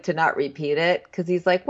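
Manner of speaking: ordinary talk, nothing marked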